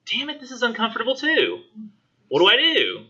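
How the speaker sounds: high, panicky mock whine; flustered son. Son panics after upsetting his mother, high flustered mock whine. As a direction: squeak it out like a cornered kid. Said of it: "And he's like".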